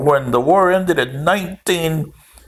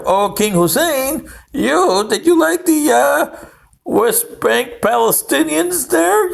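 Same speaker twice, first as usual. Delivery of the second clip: rising higher, teasing question; mock interviewer; 8 semitones higher than usual. Asking King Hussein about the West Bank, higher and teasing. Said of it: "I wonder if you asked King Abdullah".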